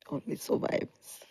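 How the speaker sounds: soft whisper, quietly firm; whispered resolve. Acted. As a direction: breathe it out with quiet strength. Cancer talk, defiant resolve.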